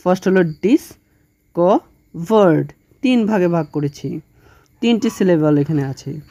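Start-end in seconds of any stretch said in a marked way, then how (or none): 0.4-2.7 ডিসকভার্ড said slowly in three syllables, dis-co-vard; careful syllable count for students; split into syllables. Shown as a demonstration. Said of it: "ডিসকভার্ডকে আমরা কয় ভাগে ভাগ করতে পারি".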